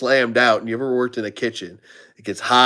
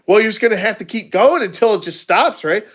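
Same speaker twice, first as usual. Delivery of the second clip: teasing quote; 8 semitones higher, 3 dB louder than usual. Retold or imitated. Retold teasing line to coworker.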